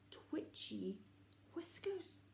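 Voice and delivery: whispered twitchy whiskers. A narrator's reading. Storyteller describing the creature's whiskers, whispered. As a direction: breathe the words mysteriously.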